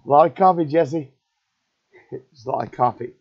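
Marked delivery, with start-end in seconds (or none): 0.0-1.1 sudden loud, high burst of emphasis; loud exclamation. Real, not acted. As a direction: blurt it out big and high to Jesse. Exclaiming about coffee to Jesse.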